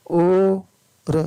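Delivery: ordinary delivery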